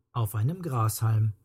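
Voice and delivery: ordinary delivery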